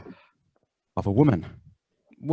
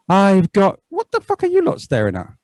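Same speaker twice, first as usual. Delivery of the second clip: mock indignant snap; 7 semitones higher, 3 dB louder than usual. Pretend woman snaps at onlookers.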